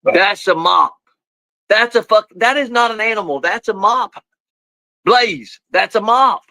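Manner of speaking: high, incredulous telling-off; high exasperated dog talk. Real, not acted. Owner telling Blaze the thing he barks at is a mop, high and exasperated. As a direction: raise the pitch and tell the dog it's just a mop.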